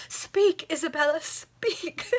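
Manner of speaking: high, slow, swooning lover voice; over-the-top romantic begging; melodramatic plea. Acted. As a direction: ham up the desperate suitor.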